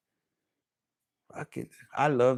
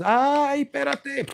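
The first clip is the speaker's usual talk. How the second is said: imitated woman's moan; 9 semitones higher, slower than usual. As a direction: imitate a woman's drawn-out high cry. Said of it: "I love when a woman just".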